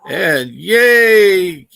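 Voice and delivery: loud, high sarcastic yay; mock cheer. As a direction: cheer it out with fake joy. Mock cheer as kids wake.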